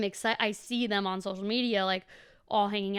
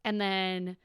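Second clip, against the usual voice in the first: ordinary talk, nothing marked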